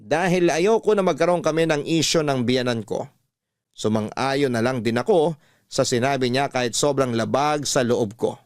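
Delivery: usual speaking voice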